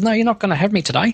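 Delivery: high, fast voice for a stubborn computer; voicing the computer. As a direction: pitch it way up and rattle it off as the PC. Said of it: "his main system has decided to call it quits and says".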